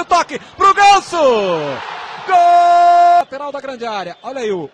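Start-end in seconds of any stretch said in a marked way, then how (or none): 0.0-3.2 high loud goal call; goal shout. Real, not acted. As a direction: explode on the goal.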